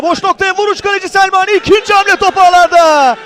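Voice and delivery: loud, high goal call; screaming football commentator. Real, not acted. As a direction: shout the goal with rising excitement.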